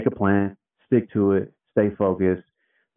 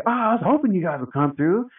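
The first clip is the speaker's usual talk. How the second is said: much higher, eager and thrilled; delighted fan; 12 semitones higher than usual. Retold or imitated. Older worker thrilled the crew showed up, high eager gush. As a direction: gush like a fan finally meeting them.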